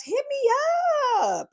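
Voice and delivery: high, singsong invitation; playful invite. Real, not acted. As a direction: sing it out to would-be guests. Calling for guests.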